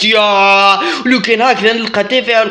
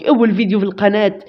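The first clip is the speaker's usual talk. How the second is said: ordinary talk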